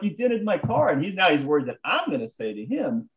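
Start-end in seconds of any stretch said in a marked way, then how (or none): 0.0-0.9 high-pitched retold grievance; exaggerated, indignant whine in retelling; mock complaint. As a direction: play up the wounded car owner. Repeats acted complaint.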